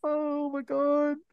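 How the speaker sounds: high, drawn-out, laughing delight; delighted laughter. Real, not acted. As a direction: gasp it out slowly while still cracking up. Cracking up over the accent.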